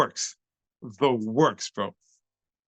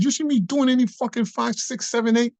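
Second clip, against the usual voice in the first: high, incredulous retort; mocking challenge; 11 semitones higher than usual. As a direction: scoff at the dance count. Mocking the dance count while retelling.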